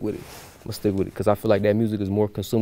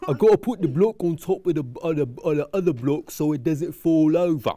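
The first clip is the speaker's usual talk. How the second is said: put-on higher British accent; British accent, pitched up; 6 semitones higher than usual. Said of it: "Do a British accent for the rest of the video".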